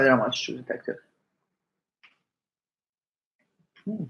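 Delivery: talking through a mouthful; words around food; chewing while talking. Real, not acted. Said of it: "sorry guys, I'm chewing a lot".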